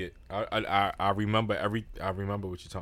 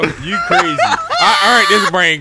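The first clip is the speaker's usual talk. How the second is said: loud, high, laughing comeback; cracking up while protesting; laughing shout; 8 semitones higher, 7 dB louder, slower than usual. Real, not acted. Laughing back at the teasing.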